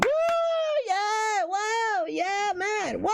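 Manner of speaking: very high hammed-up whoops; squealing mock applause for the empty promise; mock cheering. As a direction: cheer like a fake crowd at a press event. Mock crowd cheering pledge.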